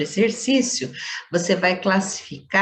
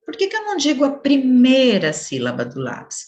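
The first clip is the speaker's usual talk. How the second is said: higher-pitched pupil querying the teacher; questioning pupil; 6 semitones higher, 4 dB louder than usual. Acted. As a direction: put the doubt as a puzzled student would. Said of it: "Você pode dizer". (aluno questionando a professora, voz mais aguda)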